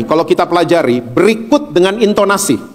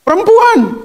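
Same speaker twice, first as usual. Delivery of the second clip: high, abrupt blame; Adam menyalahkan; slower than usual. Acted. (menuduh dengan nada melengking)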